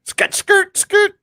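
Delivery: very high, loud squealed "skirt"; mock screech effect. A listener adds effects to the comic, high screeching squeal.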